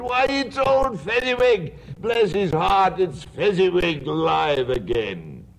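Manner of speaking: high, thrilled cry of joy; great excitement. Acted. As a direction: burst out with delight. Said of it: "Scrooge cried in great excitement".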